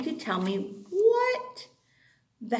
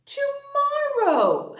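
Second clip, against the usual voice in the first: drawn-out question; 13 semitones higher, slower than usual. Shown as a demonstration.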